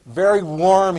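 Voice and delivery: loud mock cheer on very warm; sarcastic loud very warm; facetious. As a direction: say very warm loudly, meaning the opposite. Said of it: "he says facetiously".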